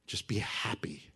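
soft unvoiced coaxing whisper; mocking whisper. False friend coaxing the listener, whispered. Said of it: "try and convince you".

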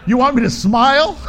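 laughing mock protest. Preacher acting out his DMV photo protest, loud and laughing. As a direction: play up the outrage for laughs.

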